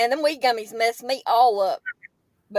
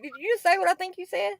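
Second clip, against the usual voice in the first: higher, softer voice for the cat; cat's imagined reaction; 7 semitones higher, 5 dB quieter than usual. Retold or imitated. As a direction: voice the cat's disbelief, high and quiet. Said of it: "looked at me like".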